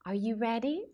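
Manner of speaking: ordinary delivery, nothing marked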